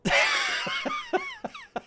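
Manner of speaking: loud high burst, tailing off; real high laughing fit; cackling laughter. Real, not acted. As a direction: cackle at your own cheek. Cracks up at own reply.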